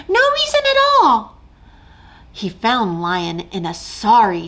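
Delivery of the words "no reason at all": fast, much higher squeaky voice; tiny rescuer hurrying; eager mouse. Acted. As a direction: rush to reassure.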